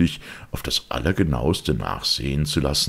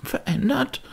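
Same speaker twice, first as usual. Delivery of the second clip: shrill disbelieving echo, leaping up; incredulous cry. Acted. Butler echoing the lawyer in shocked disbelief. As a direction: fling the word back in disbelief. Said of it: "rief Poole".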